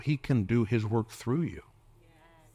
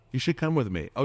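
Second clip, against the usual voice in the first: ordinary talk, nothing marked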